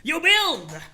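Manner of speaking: high, loud exclaim; emphatic answer. Acted. Sprite answers John's question.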